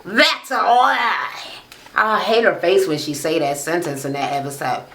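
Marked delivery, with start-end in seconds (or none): 0.1-1.4 high, very drawn-out denial; hammed-up slow drawl, pitched up; mocking catchphrase imitation. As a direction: stretch each word like a theatrical accuser. Mimicking cast member's catchphrase.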